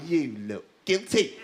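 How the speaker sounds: low, slow accusation; slow, ominous accusing voice; accusing captain. Acted. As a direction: draw it out low, like an inner accuser. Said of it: "The captain is going".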